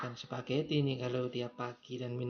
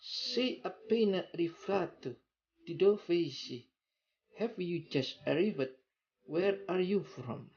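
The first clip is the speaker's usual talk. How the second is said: higher, lively Italian question; Italian accent; 4 semitones higher than usual. Shown as a demonstration. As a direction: ask it Italian style. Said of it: "on the phone".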